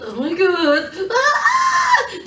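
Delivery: laughing mock shriek; imitated panic. Eve panicking after hitting him, high laughing shriek. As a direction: freak out with a high laughing yelp.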